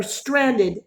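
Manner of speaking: usual speaking voice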